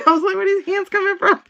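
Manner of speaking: high, loud, laughing retelling; laughing after a scare. Real, not acted. Reader telling viewer what scared her, laughing. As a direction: squeal it through real laughter. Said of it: "Ooh, girl, that scared me".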